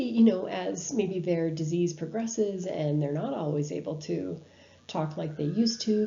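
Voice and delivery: normal talking voice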